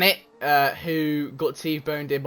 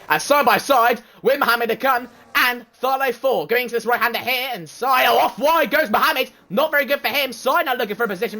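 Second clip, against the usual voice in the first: fast, lively play-by-play calling the race; race commentary; 3 semitones higher than usual. Acted. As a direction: call every overtake like a live broadcaster.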